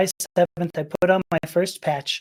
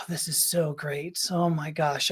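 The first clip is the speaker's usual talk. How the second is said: soft, slow sigh of relief; hushed, drawn-out contentment; retold blissful relief; 2 semitones lower, 7 dB quieter, slower than usual. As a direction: sigh it out quietly, savouring the relief. Acts out relief at first drink.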